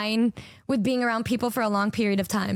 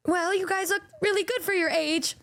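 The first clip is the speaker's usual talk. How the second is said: higher, sugary nurse voice; mock sweet compliment; 6 semitones higher than usual. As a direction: patronize them sweetly, pitched up. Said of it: "the girl next to him was all".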